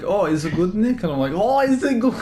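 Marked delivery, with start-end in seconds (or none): 0.0-1.0 coworker's question, rising, lightly imitated; lightly imitated Chinese accent. Retelling a coworker's question to a friend, lightly imitated accent. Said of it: "she's like". 1.3-2.1 high, loud mock Chinese accent; mimicked Chinese accent. Blurting it back to the coworker, mimicked Chinese accent. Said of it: "in a Chinese".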